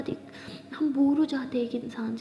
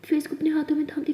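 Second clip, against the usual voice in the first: ordinary delivery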